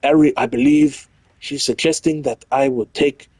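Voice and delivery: hammed-up French accent in a changed voice; letter read in a mock French accent; Frenchy accent. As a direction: do your worst French accent. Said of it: "I tried doing Frenchy".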